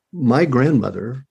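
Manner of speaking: ordinary talk, nothing marked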